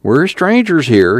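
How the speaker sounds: cowed, deferential whine; servile whine. Acted. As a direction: whine it, eager to placate. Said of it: "his voice took on a servile whine".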